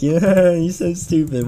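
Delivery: words drawn out through an acted laugh; laughing hard, retold. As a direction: imitate a friend cracking up. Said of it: "choking to death laughing so hard".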